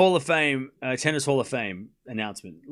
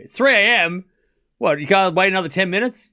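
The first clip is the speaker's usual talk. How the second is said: loud, high-pitched disbelief; raised, scoffing challenge; mock incredulity; 5 semitones higher, 6 dB louder than usual. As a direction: throw the complaint back incredulously. Throws complaint back in disbelief.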